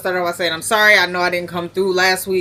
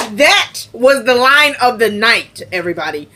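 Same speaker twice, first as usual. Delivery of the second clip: emphatic proclamation; 3 semitones higher, 4 dB louder than usual. Real, not acted. Host crowning the line of the night, emphatically.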